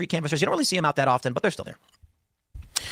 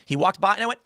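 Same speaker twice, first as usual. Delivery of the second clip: ordinary delivery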